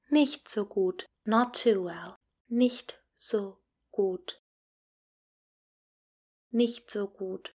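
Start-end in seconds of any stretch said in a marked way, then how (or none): 2.5-4.3 slow, careful teacher model; each word given room for the class to repeat. Shown as a demonstration. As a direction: spell it out slowly for learners to copy. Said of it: "Please repeat after me".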